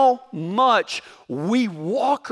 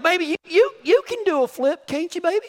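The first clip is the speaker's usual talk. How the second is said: sweet-talking wife; 9 semitones higher, faster than usual. Retold or imitated. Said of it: "I was like".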